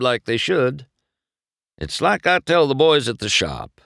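put-on high, earnest old racer; fatherly veteran counsel; the king, advising. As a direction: give the rookie real advice.

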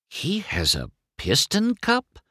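stunned high-pitched question. A narrator's reading. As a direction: pitch it high in disbelief, a touch hushed. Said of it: "McQueen whispered, stunned".

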